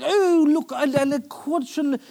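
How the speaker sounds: high, loud hammed-up posh praise; mock refined voice gushing over chicken; mock posh guest. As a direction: overdo the elegant dinner guest. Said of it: "it's like".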